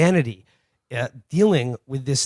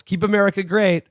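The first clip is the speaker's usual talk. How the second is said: a mock yell, a bit higher in pitch; yelled parting shot; 6 semitones higher than usual. Trump voter yells at the canvasser's back, mock yell. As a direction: imitate a guy taunting from his porch. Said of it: "he just yells".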